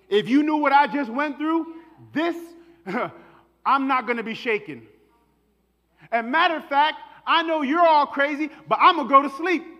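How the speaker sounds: high, loud, defiant boasting; triumphant shout. Acted. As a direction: shout your peace at the storm. Believer boasting through the storm.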